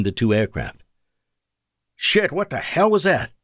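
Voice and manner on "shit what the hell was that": high startled outburst; shocked. Acted. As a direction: caught off guard by a blast. Blinding shockwave rocks fighter.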